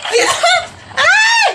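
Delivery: shrieking high and loud; scream. Acted. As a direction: scream as the ride drops. Roller coaster drop.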